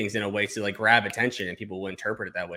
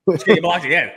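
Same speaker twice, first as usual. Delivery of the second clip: louder, higher, fed up; exasperated outburst; 6 semitones higher, 7 dB louder than usual. Real, not acted. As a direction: snap it out in disbelief. Exasperated retort.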